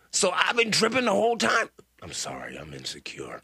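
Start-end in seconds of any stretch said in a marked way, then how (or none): 2.0-3.4 small, much softer, lower fake apology; mock tiny apology. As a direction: shrink into a hushed mock-sorry voice. Host fakes apology after interrupting.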